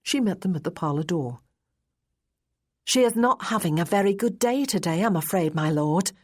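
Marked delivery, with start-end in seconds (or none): 2.9-6.1 lightly put-on young lady's voice, pitched up at first; a young governess reporting to his lordship; higher-starting, petulant young woman. A narrator's reading. Petulant young lady reports to Marquis.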